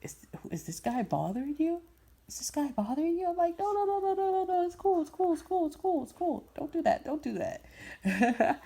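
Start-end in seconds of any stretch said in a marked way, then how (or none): 0.0-3.3 overprotective friend. Retold or imitated. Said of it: "He was really overprotective with me". 3.6-8.6 high string of hasty reassurances; squeaky retold pleading to let it go; high-pitched placating. Retold calming of overprotective friend.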